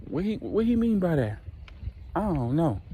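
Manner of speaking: high, alarmed wife's voice; a wife suddenly lost and worried; confused wife. Retold or imitated. Wife demanding what her husband's lover meant, high alarmed voice. Wife asks what's going on.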